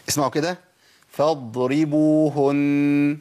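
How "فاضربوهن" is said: فاضربوهن said slowly, the ض held and flowing; correct Arabic dad. Shown as a demonstration. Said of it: "ده الصواب". (الضاد في فاضربوهن رخوة بزمن طبيعي)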